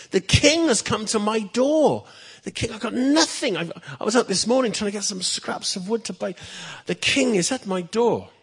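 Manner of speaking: high, loud, amazed gasping; can't believe the king is here; astonished disbelief. Acted. Poor peasant amazed the king stands at his door, high and loud. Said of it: "Old Vladimir couldn't believe it".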